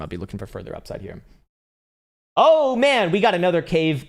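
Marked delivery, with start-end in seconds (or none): 2.4-4.1 high, loud happy exclamation; excited. Real, not acted. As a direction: burst out delighted. New cave dweller member joins.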